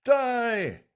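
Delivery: high, pushed voice of a hero holding on; mock strained dying hero. Dying hero straining to hold, high pitched. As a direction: strain as if holding up a collapsing wall.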